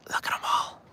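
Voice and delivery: hushed whisper. Real, not acted. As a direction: whisper it over the water. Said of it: "Kaylee, why stop?".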